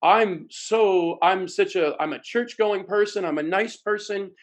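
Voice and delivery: mock the buyer justifying himself; self-righteous buyer. Salespeople excuse their own buying habits, self-righteous.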